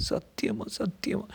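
high-pitched female voice swearing innocence; earnest woman. A narrator's reading. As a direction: swear it, pleading to be believed.

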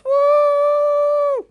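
high, loud sustained "woo"; long high-pitched party cheer; celebratory whoop. Real, not acted. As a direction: cheer like an applauding crowd. Cheering for father's birthday applause.

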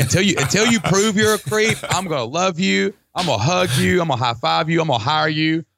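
high, gushing list of promises; exuberant pledge. Acted. Acts out trusting people wholeheartedly.

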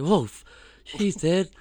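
imitated startled protest; retold startled daughter. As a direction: react as if dad just burst in.

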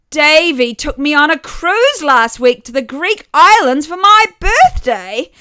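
a mother showing off, glowing with pride; beaming mother. A narrator's reading.

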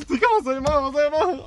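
high, hurried mock-panicked cry; reenacted panic. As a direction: plead for instructions as the attacker closes in.

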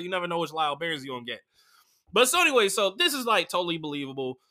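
normal talking voice

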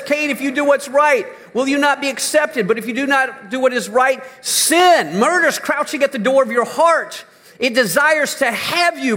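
high, loud pulpit voice reading scripture; high impassioned preaching; preaching at full force. Acted. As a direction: thunder God's warning to Cain. Preacher voicing God's words.

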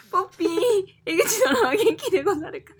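giggling through the words; reading through laughter; laughing while reading. Real, not acted. Reading letter, cracking up.

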